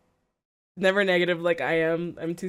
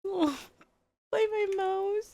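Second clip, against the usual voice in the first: cute high farewell; 12 semitones higher, 5 dB quieter, slower than usual. Real, not acted. Waving off streamer affectionately.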